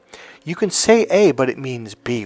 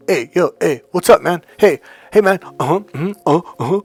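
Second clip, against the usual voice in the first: short loud grunting guy greetings, higher pitched; caveman grunts; 6 semitones higher, 5 dB louder than usual. Shown as a demonstration. Coach shows how men greet each other, grunting loudly. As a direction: bark out dude grunts like a caveman. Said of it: "men grunt".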